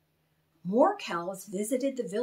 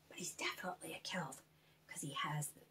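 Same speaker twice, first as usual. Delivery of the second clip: quick unvoiced whisper; fast whisper; 5 semitones lower, 13 dB quieter, much faster than usual. Host sharing Koll's secret with children, quick whisper. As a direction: rush it out as a secret.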